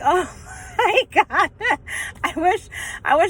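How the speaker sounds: high voice breaking up with laughter; laughing. Real, not acted. Storyteller recalling nervous boyfriend, helpless laughter. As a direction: laugh through the words.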